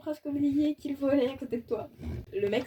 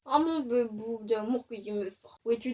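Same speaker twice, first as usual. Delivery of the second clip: plus doux et plus lent, comme à un animal qui dort; tendre au chat; 2 semitones lower, 5 dB quieter than usual. Real, not acted. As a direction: une voix câline pour ne pas réveiller le chat. Parle tendrement au chat endormi. (la lectrice parle tout doucement à son chat qui dort)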